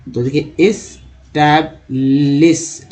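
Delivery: एस्टैब्लिश stretched out slowly; slow demo word. Shown as a demonstration. Teacher breaks the word into parts, slowly. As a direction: sound out एस्टैब्लिश for learners. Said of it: "establishment".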